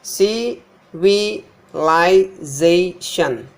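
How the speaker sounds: civilization very slowly, ci...vi...li...za...tion; syllable by syllable. Shown as a demonstration. Teacher breaking down the word, civilization syllable by syllable. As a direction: separate each syllable of civilization with pauses. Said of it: "This Z is stressed".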